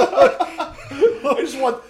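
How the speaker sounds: high breathless laugh through words; words swallowed by laughter; laughing hard. Real, not acted. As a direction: squeal it out laughing.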